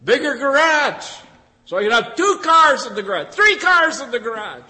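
loud, high, gloating planner; a greedy farmer bragging loudly about his barns; boastful rich farmer. Acted. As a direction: crow about bigger barns. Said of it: "He said".

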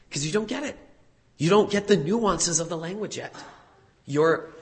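ordinary talk

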